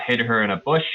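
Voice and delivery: ordinary talk, nothing marked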